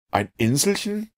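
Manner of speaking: very high, rising echo question; incredulous question. A narrator's reading. Professor questions Ned about his refuge, incredulous. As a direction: query it in astonishment.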